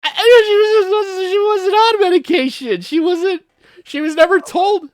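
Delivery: very high-pitched, squeaky protest; flustered protest. Real, not acted. Defending a game character to a friend, squeaky protest.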